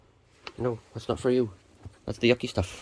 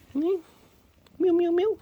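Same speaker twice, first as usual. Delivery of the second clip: high, louder cat meows; meowing; 4 dB louder than usual. Real, not acted. Farmer meowing to kittens in a high voice. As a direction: meow back at the kittens.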